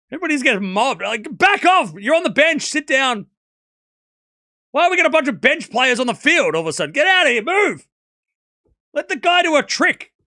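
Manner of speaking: loud, high shouting that builds to the end; ranting shout. Real, not acted. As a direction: shout them off the field. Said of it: "Stop screaming".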